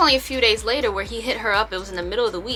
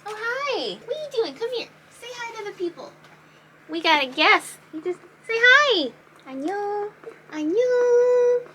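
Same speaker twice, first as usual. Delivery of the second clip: pet talk; 12 semitones higher than usual. Real, not acted. Greeting her pet on camera, high cooing baby talk. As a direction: coo at the pet in a high singsong voice.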